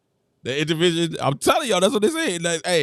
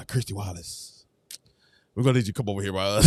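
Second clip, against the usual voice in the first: much lower, soft, casual benching; mock Australian coach; 11 semitones lower, 7 dB quieter than usual. Australian coach quietly benching the player, low and soft. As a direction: drop low and soft to call her over. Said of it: "They're like".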